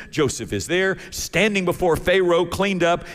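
usual speaking voice

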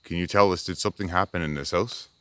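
loud, deliberate questions into darkness; calling out to spirits. Real, not acted. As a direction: address an unseen presence. Asks spirits questions aloud.

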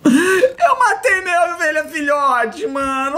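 laugh-crying. Real, not acted. Laughing-crying over dead sheep.

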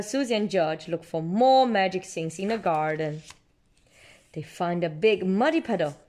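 usual speaking voice

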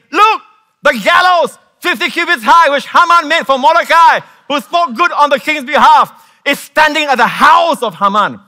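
high, loud proclaiming shout; high-pitched loud shout; urgent shout. Acted. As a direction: cry it out to the king like an alarm. Preacher voicing eunuch's urgent report.

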